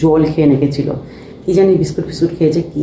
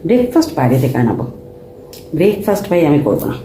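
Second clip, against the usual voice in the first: usual speaking voice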